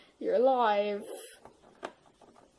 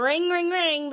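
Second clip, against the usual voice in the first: phone ring imitation; 7 semitones higher, 7 dB louder, slower than usual. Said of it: "This is a phone ringing".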